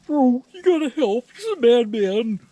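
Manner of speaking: high, pleading frantic plea; frightened spook. Acted. A spook begs the homeowner for help against a madman, high and panicked.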